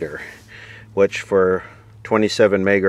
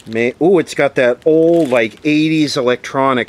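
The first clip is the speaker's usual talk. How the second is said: excited sniff; 6 semitones higher, 5 dB louder than usual. Real, not acted. Vlogger opening an old radio, excited. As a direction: voice jumps up, louder, thrilled by the smell.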